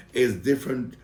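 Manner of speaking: normal talking voice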